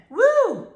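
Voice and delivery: high, loud whoop of joy; excited whoop. Real, not acted. Vlogger whooping to viewers about new content, excited. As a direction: let out a big celebratory woo.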